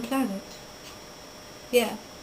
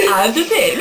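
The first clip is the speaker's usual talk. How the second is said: normal talking voice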